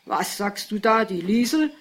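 loud, outraged father's question; narrated shouting. A narrator's reading. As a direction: raise the voice at the wife in disbelief. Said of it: "schrie der Vater". (laut polternder Vater, empört gefragt)

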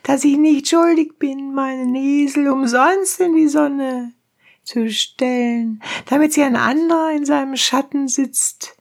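very high, protesting donkey driver; high-pitched indignant peasant voice; crying donkey driver. A narrator's reading. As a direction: plead your case in a high cry. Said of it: "schrie der Eseltreiber".

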